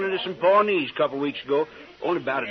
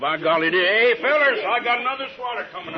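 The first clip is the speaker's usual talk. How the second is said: a man warmed by the jug, hollering for more; tipsy; 7 semitones higher than usual. Acted. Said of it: "Liquor's working on Si".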